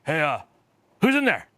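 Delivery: lightly voiced nosy boss; hailing through the stall door; mock boss calling out. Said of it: "and then he's like".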